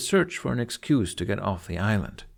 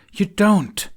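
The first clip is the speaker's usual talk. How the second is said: loud, high, final You don't; firm, no arguing; 11 semitones higher, 8 dB louder than usual. A narrator's reading. Astrid cutting off Fishlegs's protest, firm and loud. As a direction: shut it down, no room to argue. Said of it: "there would be no arguing".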